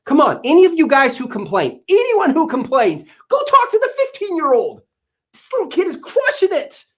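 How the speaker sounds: rising exasperated rant at complainers; emotional rant. Real, not acted. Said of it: "I just get a little emotional when I hear whiners".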